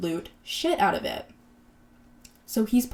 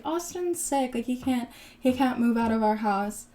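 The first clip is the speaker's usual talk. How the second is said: mock sympathetic excuse; 4 semitones higher than usual. Retelling the wife excusing her sick husband, mock sympathetic. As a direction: lift the pitch and plead his case in mock concern.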